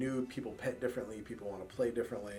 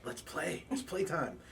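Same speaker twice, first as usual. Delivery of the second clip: a light, higher playful puppy voice; excited puppy; 8 semitones higher, slower than usual. Retold or imitated. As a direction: voice the eager puppy begging to play. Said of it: "he's immediately going".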